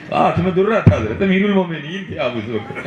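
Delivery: ordinary talk, nothing marked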